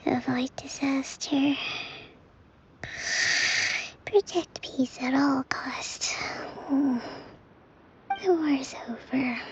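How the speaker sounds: slow whispered murmur in her sleep; sleep-talking whisper. Acted. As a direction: whisper it dreamily, eyes closed.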